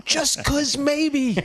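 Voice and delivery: high, drawn-out comic lament; high-pitched, slow mock anguish; mock regret. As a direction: wail over the one that got away. Mock anguish at missed match, amid laughter.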